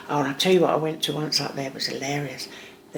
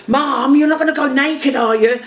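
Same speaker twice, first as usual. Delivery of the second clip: higher and louder mock daughter; raised, mock-scandalised daughter voice; imitating shocked daughter; 8 semitones higher, 6 dB louder than usual. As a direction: play the daughter aghast at her mum. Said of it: "She went".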